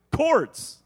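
high, loud burst of nostalgia; excited exclamation. Real, not acted. Preacher remembering old phone cords, an excited high outburst.

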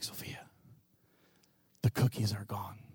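very soft bedside whisper; hushed whisper. Retold or imitated. Dad creeping to toddler's bed, whispering. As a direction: whisper it low to a sleeping child.

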